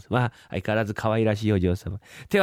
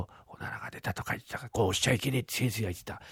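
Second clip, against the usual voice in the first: fast, soft whisper to himself; whispered aside; 9 dB quieter, faster than usual. Acted. Apprentice whispering to himself during the exam, fast. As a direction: whisper it so the patient won't hear. Muttering to himself while examining.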